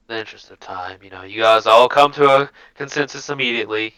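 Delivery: ordinary talk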